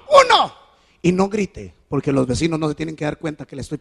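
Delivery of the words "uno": high loud shout of the count; father counting blows as he spanks; sharp counting shout. Retold or imitated. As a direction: bark out the first blow.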